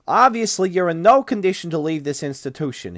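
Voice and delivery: lightly voiced doctor objecting; stiff clinic doctor. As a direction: play the flustered doctor a notch up. Doctor figure in read story.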